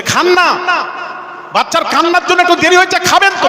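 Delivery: high, pleading voice of the wife. Acted. Wife begging husband to eat, high and pleading.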